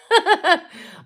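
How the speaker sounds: high, bright burst of laughter; sudden real laugh. Real, not acted. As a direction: laugh out loud at the comment. Laughs reading a chat comment.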